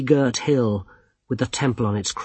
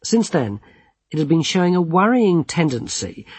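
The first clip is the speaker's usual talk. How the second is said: ordinary delivery, nothing marked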